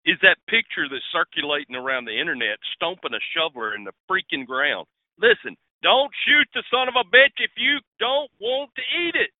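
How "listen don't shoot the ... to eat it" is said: high, heated, fired-up rant; real passion, pitch climbing high; passionate outburst. Real, not acted. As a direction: let the passion pour out. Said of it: "the passion is exuding from his voice".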